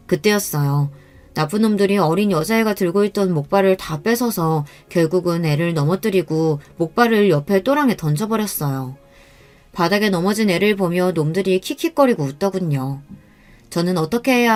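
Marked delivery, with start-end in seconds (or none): none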